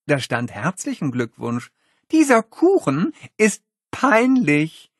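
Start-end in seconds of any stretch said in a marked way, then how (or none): 2.1-4.9 high and slow scornful verdict; drawn-out disdain. Acted. Holly judging the cake with contempt. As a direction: drag it out in contempt.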